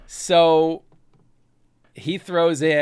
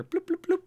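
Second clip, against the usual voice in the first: high electronic bloop sounds; chirpy machine counting bloops; high counter bloops; 3 dB quieter than usual. Acted. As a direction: beep like a credit counter.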